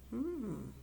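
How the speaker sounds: plain reading voice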